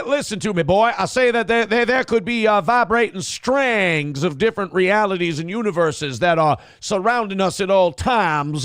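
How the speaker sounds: blustering, high-pitched Southern cartoon bluster; Foghorn Leghorn. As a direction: stammer and boom it like a pompous Southern rooster. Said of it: "When you talk like Foghorn Leghorn".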